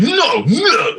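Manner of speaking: high, imitated Japanese man's protest; Miyazaki kind of voice. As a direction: pitch it way up, mock anime-style objection. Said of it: "fucking Miyazaki kind of voice".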